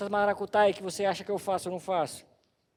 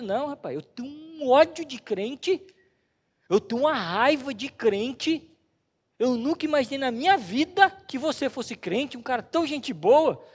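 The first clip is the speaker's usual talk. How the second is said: mock angry colleague; 5 semitones higher, 4 dB louder than usual. Colleague declares hatred.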